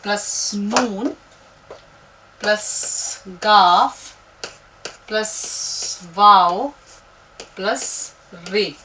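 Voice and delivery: very slow, drawn-out letter listing; teacher adding letters to build a word; slow letter count. Shown as a demonstration. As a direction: count the letters off one by one slowly. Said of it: "अब देखिए, अंगूर हमने लिखा".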